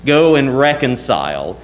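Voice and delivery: ordinary talk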